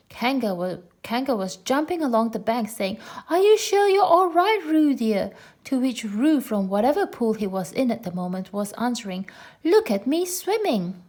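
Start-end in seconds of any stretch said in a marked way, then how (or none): 3.3-5.3 much higher, louder worried call; a mother calling along the bank; high, loud anxious call to her child. A narrator's reading. 9.6-11.0 little Roo. A narrator's reading. Roo showing off.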